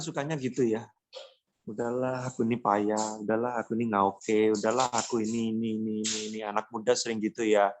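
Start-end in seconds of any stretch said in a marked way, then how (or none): 1.7-6.4 mock defeated sigh. Voicing defeated self-talk.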